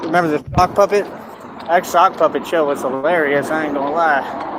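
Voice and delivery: usual speaking voice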